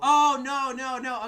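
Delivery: loud, high burst of remembering; blurting out as it comes back; sudden realization. Real, not acted. Host suddenly recalls his point, loud high burst.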